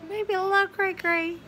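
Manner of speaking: high, sing-song teasing voice; coyly owning up to being a bit nuts; playful. Real, not acted. Playful admitting to craziness.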